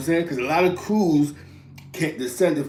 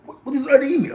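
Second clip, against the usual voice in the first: puzzled question; 11 semitones higher, 4 dB louder, faster than usual. Retold or imitated. Confused newcomer asking about slang.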